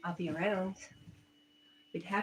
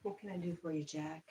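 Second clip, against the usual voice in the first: soft, gentle question; 2 semitones lower, 10 dB quieter, faster than usual. Real, not acted. Softly asking the pet.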